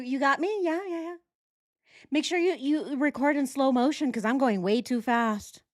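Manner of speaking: high, giddy mock voice of Nicole. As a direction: play her as breathless and show-offy. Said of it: "That's her".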